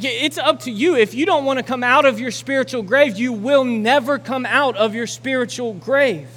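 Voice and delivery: high, loud, driving sermon climax; preaching at full force. Acted. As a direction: preach it hard, pushing the crowd to act.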